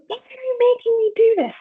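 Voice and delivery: put-on screaming child.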